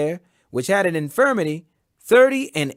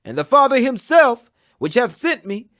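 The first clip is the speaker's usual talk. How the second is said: earnest, lightly raised preaching voice; Jesus proclaiming; 5 semitones higher, 5 dB louder than usual. Acted. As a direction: lift the voice a little and proclaim with steady authority. Jesus' long reply read aloud.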